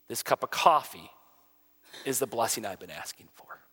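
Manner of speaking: soft, low, subdued retelling of a tearful mother; retold tearful plea. Mother thanking him before her son leaves for Iraq, subdued and sad. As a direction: quote her quietly and solemnly, with pauses. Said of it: "And she's crying".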